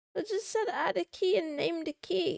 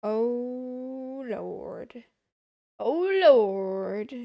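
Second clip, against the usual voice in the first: exasperated groan; 6 dB louder, much slower than usual. Real, not acted. Exasperated exclamation.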